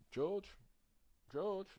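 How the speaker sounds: calling George, high and loud. Real, not acted. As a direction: call his name out across the room, rising.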